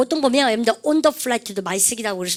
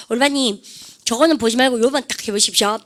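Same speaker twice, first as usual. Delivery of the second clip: normal talking voice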